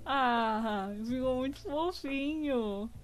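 high squeaky laugh then slow cooing praise; cutesy aww. Real, not acted. Streamer cooing over a cute demon drawing, slow high aww. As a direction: coo over it slowly, melting.

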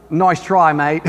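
mock Australian accent; broad, jokey Australian vowels. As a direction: imitate an Australian for a laugh. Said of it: "I could try to talk like them".

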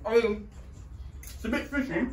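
chewing while talking; mouth full. Real, not acted. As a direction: talk through a mouthful. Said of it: "Your chewing is more unbearable".

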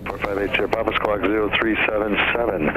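usual speaking voice

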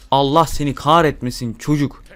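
ordinary talk

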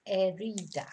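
"herida" with no H sound, starting straight on the vowel; silent H. Shown as a demonstration. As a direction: say "herida" as "eh-REE-da", dropping the H. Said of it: "we don't pronounce the H".

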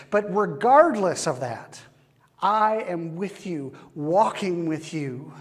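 rising fervent sermon voice; preaching at full force. Acted. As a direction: preach it out as God's promise. Preaching God's reassurance.

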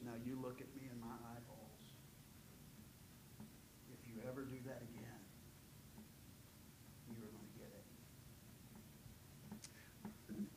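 put-on low, hushed, grave threat to a child; quiet stern warning. Shown as a demonstration. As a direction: lean in close and say it very softly. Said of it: "Very serious, very slow".

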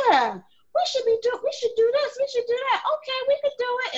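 lightly mocking her half-hearted agreeing; imitating Mary. Host mimics her co-host's lukewarm promises, high mock voice. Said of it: "she be like".

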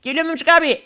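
put-on high, eager little kid voice; high kid sidekick begging to be waited for. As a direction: squeak it like a small scared boy.

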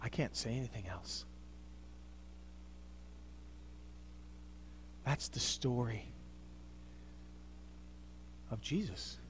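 near-silent awe; reverent hush. Acted. Preacher letting the congregation sit in joy, hushed and low.

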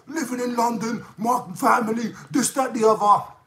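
high, put-on mock gangster swagger. A vlogger mocks a troll's gangster talk, high put-on voice. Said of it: "He talks so gangster".

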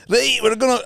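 high mock-Scottish burst; joke Scottish accent. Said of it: "They're all doing Scottish accents".

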